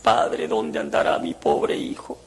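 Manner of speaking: slow, drawn-out mock wail; imitating weeping mother. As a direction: imitate a mother wailing for her son. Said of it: "pero llorando así". (imitando a una madre llorosa)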